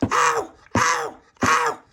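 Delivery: high, loud howls of pain; pained cry. Acted. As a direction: yelp as bricks are knocked out. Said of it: "Tony was going".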